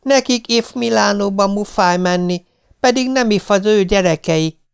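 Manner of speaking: put-on high-pitched lisping child; little child. A narrator's reading. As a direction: pipe up like a lisping small child. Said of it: "mondta a kicsi".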